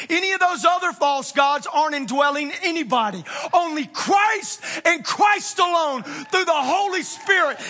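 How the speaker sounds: shouted, high, loud preaching climax; preacher shouting to the congregation, fired up. Real, not acted. Preacher to congregation, rising to a fired-up shout. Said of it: "I'm getting fired up, man".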